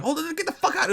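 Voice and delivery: high, sharp mock mom yelp; mom kicking him out. Mom smelling it at the door, high disgusted squeal.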